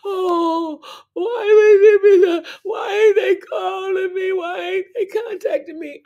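high, loud whiny wail; mock whining. Mocking the self she left behind, high whiny wail. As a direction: wail it like a needy self-pity act. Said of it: "I'm not wasting my time on".

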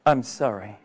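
put-on a mild sad sorry to an old friend; sorrowful refusal. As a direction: say it with quiet regret. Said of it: "And what will you tell them?".

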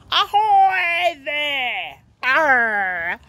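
drawn-out pirate call; jolly pirate. Acted. Ted playing pirate captain at sea, jolly pirate call. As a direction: hail the ship like a storybook pirate. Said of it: "dressing up as a jolly pirate".

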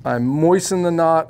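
ordinary talk

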